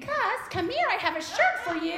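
high, sugary princess call; sing-song fairy-tale mother; high mock Cinderella call; sweet Cinderella voice.

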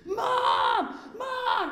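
high, loud shout from the bathroom; son yelling Mom. Retold or imitated. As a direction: yell for mom in a panic.

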